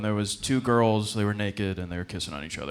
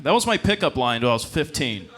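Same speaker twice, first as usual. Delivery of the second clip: ordinary delivery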